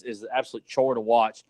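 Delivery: usual speaking voice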